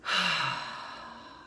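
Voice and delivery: shorter, airy falling "ahh"; cleansing sigh. Shown as a demonstration. Leading listeners in breathing out tension, audible sigh. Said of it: "like I'm doing, feel free to make noise".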